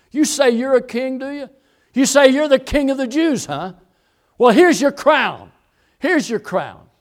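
high, loud jeering challenge; preacher's loud imitation of scornful mockers; mocking taunt. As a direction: taunt like a crowd mocking a would-be king. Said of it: "And they're mocking him".

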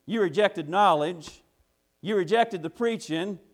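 high, loud mocking call; Wisdom taunting. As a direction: jeer like wisdom laughing at a fool. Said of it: "Wisdom is laughing, saying".

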